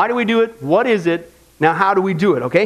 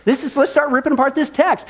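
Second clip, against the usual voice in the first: ordinary delivery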